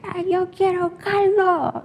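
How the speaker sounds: slow, high, slurred demand for soup; drunk man yelling. Retold or imitated. Drunk man at the restaurant demands soup, high slow yell. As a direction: play the drunk old man demanding caldo.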